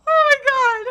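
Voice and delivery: loud, high, tearful; real overcome exclamation. Real, not acted. Reader reacting to the mother's farewell, loudly overcome. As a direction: cry it out, overwhelmed.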